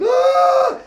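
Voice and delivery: high loud frightened cry; panicked yell. Retold or imitated. First-timer seeing the plane door open, panicked cry. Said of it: "the door's fucking open".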